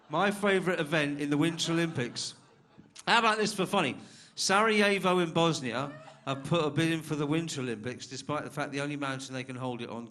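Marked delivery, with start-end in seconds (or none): 0.1-8.0 loud hype. Real, not acted. MC hyping the room.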